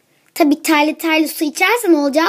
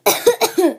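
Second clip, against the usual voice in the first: a single forced cough; acted cough; 5 semitones higher, 3 dB louder than usual. Sick girl coughing in front of her friend, acted cough. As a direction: fake a cough.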